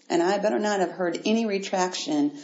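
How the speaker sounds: ordinary talk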